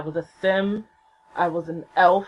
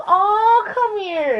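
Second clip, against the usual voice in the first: high, drawn-in "aww" before a hug; imitated comforting idol; tender sympathy; 4 dB louder, much slower than usual. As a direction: coo it high, inviting them in. Voices idol offering a hug.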